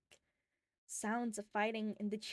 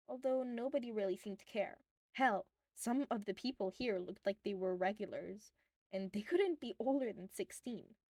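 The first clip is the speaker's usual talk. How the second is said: ordinary delivery, nothing marked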